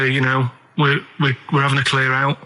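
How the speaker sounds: ordinary talk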